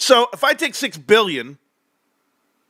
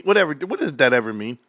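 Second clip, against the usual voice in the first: normal talking voice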